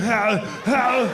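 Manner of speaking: drawn-out guttural "how, how"; strained Arnold grunt, twice; Schwarzenegger-style yell. Acted. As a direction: groan it out like a straining action hero. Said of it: "that's exactly how you sound".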